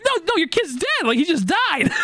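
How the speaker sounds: very high, squeaky disbelieving cry; shocked disbelief. Retold or imitated. Retelling his shock at her having a kid, squeaky disbelief. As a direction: squeal it like the news just broke your brain. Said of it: "I'm like".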